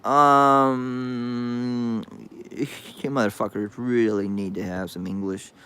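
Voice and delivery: low, quiet, and fast; low deadpan. Real, not acted. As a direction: mutter it with flat contempt. Deadpan dismissal of the chat.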